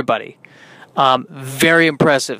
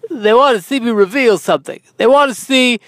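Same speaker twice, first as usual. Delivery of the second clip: high, emphatic theatrical voice; hammed-up high, grandiose delivery; odd put-on voice; 7 semitones higher, 4 dB louder than usual. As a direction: swoop up high and declaim it. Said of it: "I don't know why I'm talking in that voice".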